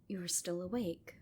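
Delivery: soft ASMR whisper; whispered storytelling. A narrator's reading. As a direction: hush it like a secret at bedtime. Whispered bedtime tale.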